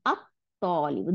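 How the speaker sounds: "আত-তলিবু" with no L sound; doubled T. Shown as a demonstration. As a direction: hold the T closed in "আত-তলিবু". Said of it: "দুইটা ত হয়ে গেল".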